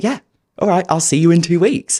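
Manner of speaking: lightly acted stunned reply; retold dazed agreement. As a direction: agree as if taken by surprise. Acted dazed reaction to panel.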